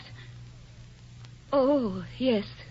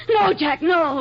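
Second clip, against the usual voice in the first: higher and louder, desperate; frantic plea; 6 semitones higher, 5 dB louder, much slower than usual. Acted. Wife to husband going out, frantic pleading. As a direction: stop him from leaving.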